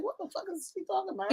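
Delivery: fast, soft and high, laughing through it; mock bewilderment while laughing; confused husband quote. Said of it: "He's like".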